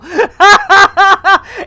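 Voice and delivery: high, very loud laughter; helpless cackle. Real, not acted. Streamer losing it over the acronym, helpless cackle. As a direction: burst out cackling.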